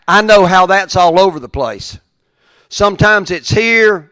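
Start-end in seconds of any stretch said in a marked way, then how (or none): 2.7-4.1 higher, louder, emphatic delivery; raised high level. Shown as a demonstration. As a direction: lift it up loud to show a high point. Faith shown as high, then low.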